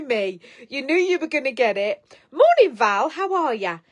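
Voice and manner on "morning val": ordinary talk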